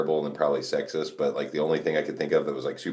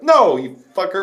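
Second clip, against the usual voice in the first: high, loud playful outburst; half-laughing mock shout; mock yell; 13 semitones higher, 7 dB louder than usual. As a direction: yell it at the rival who stole your answer. Retold outburst at rival team.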